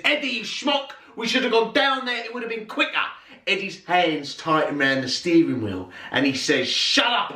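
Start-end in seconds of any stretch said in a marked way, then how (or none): 0.0-2.9 much higher, nagging complaint; retold back-seat driver, voice pitched right up; high exasperated scolding. Grandfather turns to Eddie in the car, high exasperated scolding. 6.7-7.3 high, sudden retort; exasperated outburst. Retold or imitated. Driver answers his nagging passenger, exasperated outburst. Tense driver snaps back, acted.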